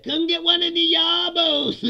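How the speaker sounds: high, loud, slow street-slang voice; character quote. Retold or imitated. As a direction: drawl it out as the book's character. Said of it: "And he's like".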